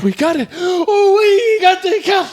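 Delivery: high-pitched squealing celebration; very happy. Retold or imitated. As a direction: act out wild joy in a shrieking high voice. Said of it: "very happy".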